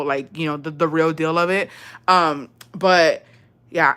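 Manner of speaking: ordinary talk, nothing marked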